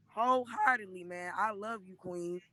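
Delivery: normal talking voice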